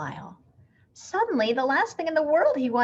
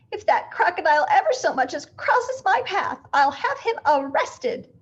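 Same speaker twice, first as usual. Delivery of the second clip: high, loud indignant threat; outraged neighbour threatening; indignant call; 7 semitones higher, 5 dB louder than usual. A narrator's reading. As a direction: call back over her shoulder, outraged. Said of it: "she called back over her shoulder".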